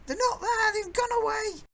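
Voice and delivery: put-on high, loud shouting old woman; old lady voice. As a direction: call across the street like an old crone. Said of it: "Old lady voice".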